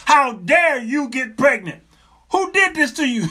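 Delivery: hammed-up shouted scolding; mock outrage, emphatic. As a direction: punch out each word like an outraged husband. Retold scolding, repeated harder.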